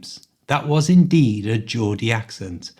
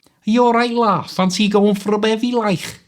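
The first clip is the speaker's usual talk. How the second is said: thick Scouse, pitched much higher; stronger Scouse accent; 9 semitones higher than usual. Shown as a demonstration. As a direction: lay the Liverpool accent on thick. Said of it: "make my accent a little stronger, like this".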